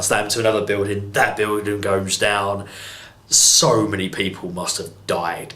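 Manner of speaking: ordinary delivery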